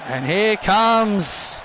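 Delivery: drawn-out arena announcement; slow build-up for the entrance; slow announcer intro. Acted. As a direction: ring announcer calling the wrestler out slowly.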